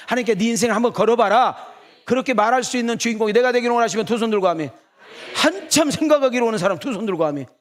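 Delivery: loud high altar call; preaching at full force. Real, not acted. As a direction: call the crowd to raise hands and shout amen. Preaching climax calls for amen. (목사가 회중에게 외치는 강한 설교)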